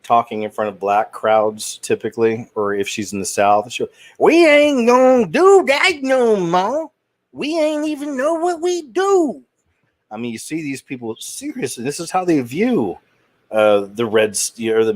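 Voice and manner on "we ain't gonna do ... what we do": hammed-up Southern accent, much higher and slow; exaggerated Southern accent, pitched way up, drawn out; mock Southern accent. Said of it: "All those clips you can find of Hillary".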